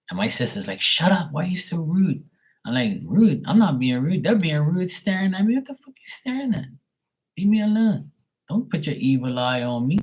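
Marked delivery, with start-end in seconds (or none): 0.8-2.2 high-pitched mock scolding; imitated scolding sister. Sister's retold scolding of the speaker. 3.0-10.0 high-pitched, indignant comeback; retold loud retort. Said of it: "You said that loud".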